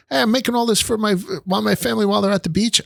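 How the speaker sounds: high, brisk imitation of a busy grandmother; fond nonna impression; old Italian grandma. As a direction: sing it out like a proud nonna in the kitchen. Voicing his Nonni cooking.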